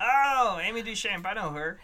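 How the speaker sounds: high, loud, delighted; bright eager recognition; excited. Acted.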